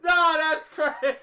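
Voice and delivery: words breaking into loud high laughter; cracking up at the clip; laughing hard. Real, not acted. Reacting to a brutal fight scene, laughing hard and loud. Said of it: "I'm dead".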